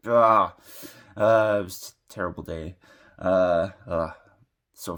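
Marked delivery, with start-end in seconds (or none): 0.1-0.5 frustrated groan. Retold or imitated. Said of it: "I was like".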